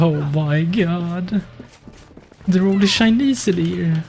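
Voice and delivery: raging gamer. Real, not acted. Stuck on laggy servers.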